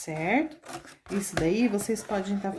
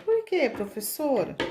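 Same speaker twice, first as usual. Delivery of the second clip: lightly voiced pupil, pitched higher; a hint of a questioning pupil; imitated pupil question; 7 semitones higher than usual. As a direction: ask higher, as a pupil to the teacher.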